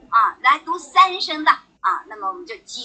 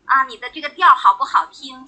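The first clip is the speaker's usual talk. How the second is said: usual speaking voice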